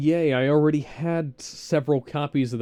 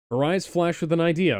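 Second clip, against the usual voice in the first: ordinary talk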